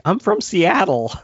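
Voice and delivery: mock character line. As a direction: play up her line slowly. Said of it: "she's like".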